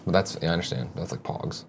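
breathy whispered repeat; whispered aside. Real, not acted. Muttering to himself during the Pokemon rant, whispered. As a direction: drop to a near-silent whisper.

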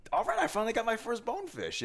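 high elated quote; excited. Retold or imitated. Telling the host about landing his first bonefish, elated. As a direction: celebrate the first catch. Quoting own joy, high.

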